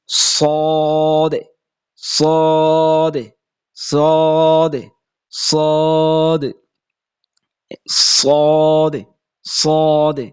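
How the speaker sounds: সদে held very long with a thick, heavy স; drawn-out letter name. Shown as a demonstration. Quran teacher drilling students on the letter সদে, slow and thick. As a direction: stretch সদে slowly each time, sound full and thick. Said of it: "সদ আওয়াজটা মোটা হবে".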